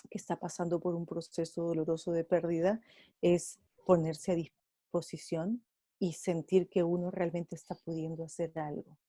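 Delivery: usual speaking voice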